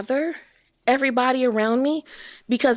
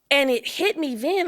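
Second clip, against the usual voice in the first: ordinary talk, nothing marked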